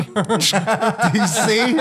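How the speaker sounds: can't keep a straight face on it; laughing through the quote. Retold or imitated. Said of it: "like that scene from Red Dragon".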